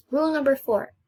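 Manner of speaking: usual speaking voice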